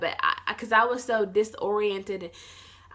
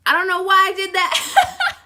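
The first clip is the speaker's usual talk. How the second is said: high, loud laughing admission; 10 semitones higher, 9 dB louder than usual. Real, not acted. Vlogger confessing her own odd reaction, laughing. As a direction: laugh through the words, pitched up.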